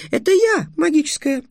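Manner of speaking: very high squeaky outcry; yelling fairy. A narrator's reading. Fairy claiming magic for herself, squealing indignantly. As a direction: shriek it in a tiny piping voice. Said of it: "завопила Аннет".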